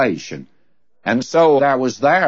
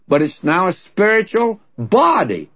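higher pitch, punching "body" after "spiritual"; stress on BODY; 6 semitones higher than usual. Retold or imitated. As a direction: set "body" apart and hit it. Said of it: "the emphasis is on body".

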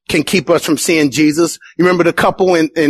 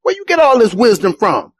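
incredulous townsfolk; 7 semitones higher than usual. Retold or imitated. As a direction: scoff in disbelief at the carpenter's boy. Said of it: "they were like".

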